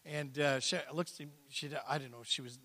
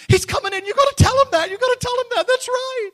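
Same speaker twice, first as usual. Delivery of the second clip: high-pitched shouting, mimicking her; imitated excited outburst; 15 dB louder than usual. Woman urging the preacher to tell her husband, mock excited shout. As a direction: cry out urgently, far louder and higher. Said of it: "She starts crying".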